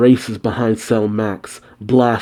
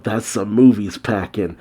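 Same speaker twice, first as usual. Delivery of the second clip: ordinary delivery, nothing marked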